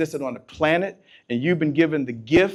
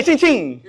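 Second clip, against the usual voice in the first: high, ringing "ching, ching"; playful ching chant; 9 semitones higher, 3 dB louder than usual. Shown as a demonstration. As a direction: chime it for the crowd to repeat. Leads audience in the ching chant.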